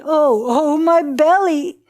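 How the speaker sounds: storyteller's full-voiced cry of agony; groaning in pain. A narrator's reading. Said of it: "She groaned".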